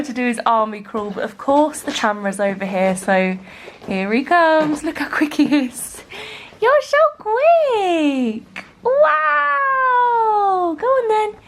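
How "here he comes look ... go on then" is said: high, drawn-out cooing praise. Real, not acted. Mum cheering on her crawling baby, high baby talk.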